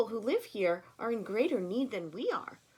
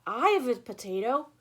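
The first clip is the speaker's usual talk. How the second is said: louder, far-off villager call; deep voice; 5 semitones higher, 7 dB louder than usual. A narrator's reading. Villager calling out from the back of the crowd. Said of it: "A deep voice in the back".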